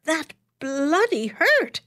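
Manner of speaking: high, drawn-out outraged protest; pained exclamation. Acted. Doctor protesting the waxing, high and outraged. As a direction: stretch it out in pained disbelief. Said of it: "he exclaimed".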